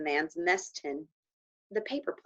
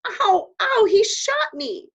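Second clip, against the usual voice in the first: high, loud cries of pain; pained scream; 9 dB louder, slower than usual. Acted. Boy shot in the cheek, crying out in pain. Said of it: "Patrick screamed".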